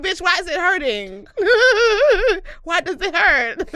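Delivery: high, loud, cracking up; laughing incredulous retort. Retold or imitated. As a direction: blurt it out through helpless laughter. Laughing retort to retold confession.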